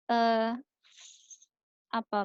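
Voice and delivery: normal talking voice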